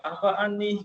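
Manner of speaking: a light sneer at the start of a video; julid opener. Shown as a demonstration. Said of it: "bikin konten julid". (nada julid ringan)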